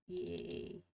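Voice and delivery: ordinary talk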